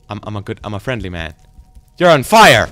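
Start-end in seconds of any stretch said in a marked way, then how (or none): 2.0-2.7 high, loud exclamation; alarmed shout. Real, not acted. Player seeing the attacker on fire, alarmed shout. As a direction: yell it in alarm.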